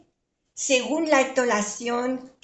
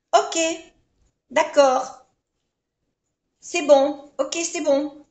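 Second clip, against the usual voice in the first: bright, higher, louder agreeing tone; agreeable ok; 5 semitones higher, 5 dB louder than usual. Shown as a demonstration. Teacher showing cheerful agreement to a suggestion. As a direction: say yes happily, pitch lifted. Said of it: "Es la entonación".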